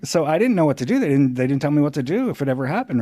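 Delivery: ordinary talk, nothing marked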